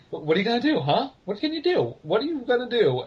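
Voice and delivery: put-on egging on a cross toddler in a high voice; teasing goading. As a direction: needle them playfully, pitch swooping up. Teacher goading his angry little daughter.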